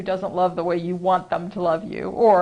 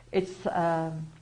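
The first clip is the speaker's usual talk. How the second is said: usual speaking voice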